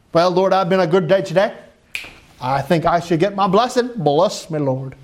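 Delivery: put-on American voice, lightly hammed up; American accent. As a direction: play a self-satisfied churchgoer in an American accent. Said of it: "put my American voice on there".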